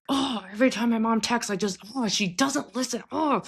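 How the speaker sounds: high, whiny exasperated groan at mom; frustrated teenager. Retold or imitated. Client mimicking a fed-up teenager complaining about mom, whiny and high. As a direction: mimic a fed-up teen venting about mom's texts. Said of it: "that kind of teenager".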